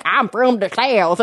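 slow, hammed-up Southern accent; caricature Southern accent. Mocking people who imitate Texans, caricature Southern accent. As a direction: stretch every vowel in a mock Southern drawl. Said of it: "people are like".

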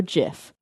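jif, starting like "jiffy"; gif with soft g. Shown as a demonstration. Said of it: "I also go jif".

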